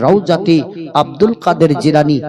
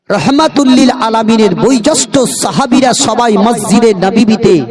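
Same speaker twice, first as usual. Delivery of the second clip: raised, ringing sermon delivery; preaching; 7 semitones higher, 4 dB louder than usual. Acted. Preacher telling of the companions in mourning, raised preaching voice. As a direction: lift the voice high to proclaim it.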